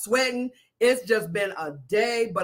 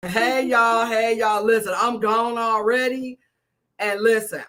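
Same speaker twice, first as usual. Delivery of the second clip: ordinary talk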